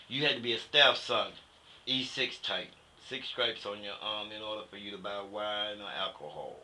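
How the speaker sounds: ordinary talk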